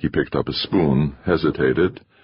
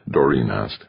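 ordinary delivery, nothing marked

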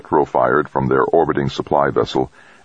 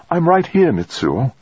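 higher voiced woman reassuring him; a colleague comforting the waking biologist, pitched higher; Trudy's voice; 13 semitones higher than usual. A narrator's reading. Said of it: "said Trudy Hall".

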